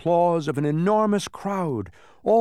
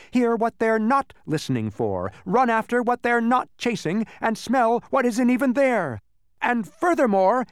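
high-pitched gleeful cackling villain; cackling demon; 8 semitones higher, 4 dB louder than usual. A narrator's reading. Demon gloating over his victims, high cackling voice. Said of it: "he cackled".

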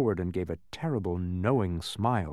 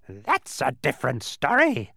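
loud, high, amiable squawk; more amiable bird; 6 semitones higher, 6 dB louder than usual. A narrator's reading. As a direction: interject as a friendlier screechy bird. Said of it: "interjected the bird a bit more amiably".

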